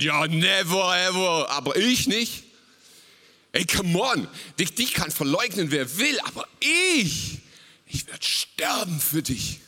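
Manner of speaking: loud, cocky promise, slowed for effect; swaggering mock vow of loyalty; boastful bigmouth. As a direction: brag like a big mouth who can't fail. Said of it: "so ein Großmaul".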